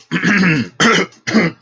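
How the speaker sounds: harsh throat clear. Real, not acted. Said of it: "I'm, like, coughing".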